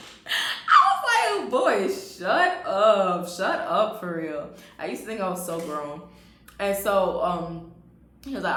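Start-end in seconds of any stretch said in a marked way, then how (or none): 0.1-4.5 flustered laugh. Real, not acted. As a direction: brush him off while giggling. Flustered by compliment.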